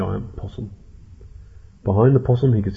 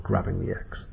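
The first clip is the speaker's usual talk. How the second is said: ordinary delivery, nothing marked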